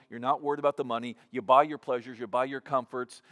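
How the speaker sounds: ordinary delivery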